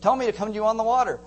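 high, loud and fast; impulsive eagerness. Retold or imitated. Peter shoots off his mouth to Jesus, eager blurt. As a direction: blurt it out loudly, all eagerness.

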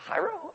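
"Chairo" high and soft, falling; a soft, high-pitched "Chairo"; exaggerated foreign pronunciation. Shown as a demonstration. Preacher apologetically showing the original word, high soft pronunciation. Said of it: "not trying to do an Oriental impersonation".

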